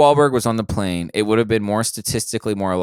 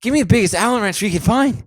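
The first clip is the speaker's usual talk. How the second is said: higher, hammed-up demanding voice; mock hero; 10 semitones higher than usual. Friends riffing, the star demands wrench and snacks. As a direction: bark orders like a cartoon tough guy. Both play Wahlberg on plane.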